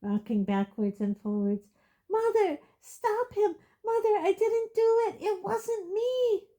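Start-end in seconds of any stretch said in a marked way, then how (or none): 2.1-6.5 high desperate scream. Acted. Boy screaming to his mother, high desperate.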